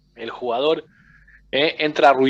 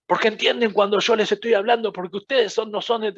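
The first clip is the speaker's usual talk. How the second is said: mock aggressive coach, pitched higher; a hint of a scolding coach; aggressive tone demo; 7 semitones higher than usual. Shown as a demonstration. Coach shows students the wrong aggressive tone, mildly harsh. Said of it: "El tono agresivo no".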